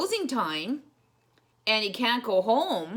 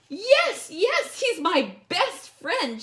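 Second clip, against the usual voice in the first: much higher, louder cry of joy; joyful shout; 12 semitones higher, 8 dB louder, slower than usual. Acted. Lisa spotting her lost bear, joyful shout. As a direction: shout it as you run to him. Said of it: "shouted Lisa".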